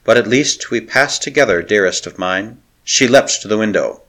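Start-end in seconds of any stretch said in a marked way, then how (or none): none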